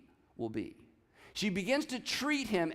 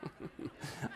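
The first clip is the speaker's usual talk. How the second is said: breathy laugh; 3 semitones lower, 13 dB quieter than usual. Real, not acted. Laughing at his own joke.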